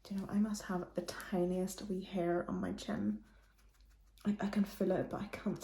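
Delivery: hushed aside under the breath; whispering. Real, not acted. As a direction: whisper it to yourself. Tiny hair, private aside.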